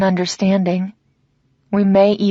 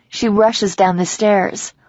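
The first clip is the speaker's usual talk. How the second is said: ordinary delivery, nothing marked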